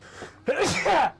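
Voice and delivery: sudden loud sneeze bursting out. Real, not acted. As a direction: let a big sneeze explode. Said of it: "Ik moet niezen".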